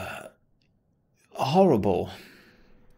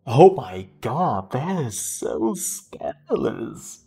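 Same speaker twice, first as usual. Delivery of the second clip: slow, drawn-out fake shock; mock scandalized; 3 semitones higher, 3 dB louder, slower than usual. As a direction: drag it out, sarcastic and theatrical. Mocking the NPC's dramatic line.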